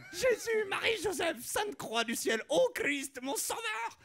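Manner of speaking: very high, crying, shouting prayer; hammed-up mystic rapture; religious ecstasy. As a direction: wail like a woman seeing saints. Ecstatic vision of the Virgin.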